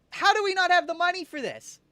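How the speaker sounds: high, loud, incredulous question; can't believe it; exasperated disbelief. Real, not acted. Short of money in the game, incredulous.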